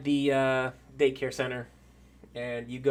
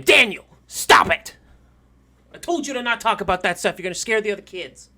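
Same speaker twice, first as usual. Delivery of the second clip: loud, raised-pitch telling-off; scolding daycare lady; 6 semitones higher, 10 dB louder than usual. Acted. Daycare lady scolds Daniel for scaring kids, loud and stern. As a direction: cut the child off with a sharp, loud scolding. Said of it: "the lady who watches the children, um, looks and goes".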